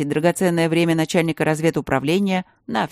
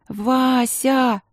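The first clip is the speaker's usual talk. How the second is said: высоко, с надрывом; выкрикнуть имя с мольбой; отчаянный возглас; 8 semitones higher than usual. A narrator's reading. Последняя мольба. (Алинка в отчаянии взывает к сестре)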